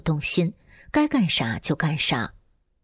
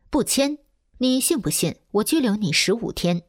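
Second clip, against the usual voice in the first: higher-pitched threat, only mildly roared; mild angry roar; 5 semitones higher than usual. A narrator's reading. Policeman threatens woman who won't sign, mild angry roar. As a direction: read an angry threat without raising the volume. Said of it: "吼道".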